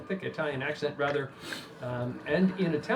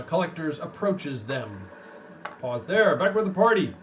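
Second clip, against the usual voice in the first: game master narration; 4 semitones higher than usual. Acted. Game master describing the docks to the players, in a raised narrating voice. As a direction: paint the dock scene for the party. GM sets the scene.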